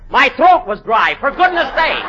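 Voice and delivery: exasperated, rising self-excuse; indignant defence. Acted. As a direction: protest as the wronged party. Actor indignantly defends himself.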